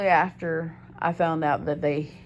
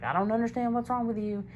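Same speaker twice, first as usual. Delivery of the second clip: imitating a baffled boyfriend, pitched up; exasperated incomprehension; 7 semitones higher than usual. Boyfriend to freaked-out girlfriend, exasperated. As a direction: throw up a high-pitched, bewildered complaint.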